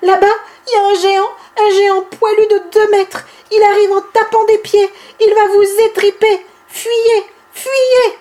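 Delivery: high, loud fake panic; mock alarmed shout. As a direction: yell a made-up warning about a giant. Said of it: "il se met à crier". (crier une fausse alerte, aigu et fort)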